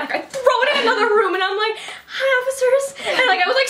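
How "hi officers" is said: high, slow mock-timid greeting; scared. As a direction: greet them like you're nervously hiding something. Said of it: "so scared".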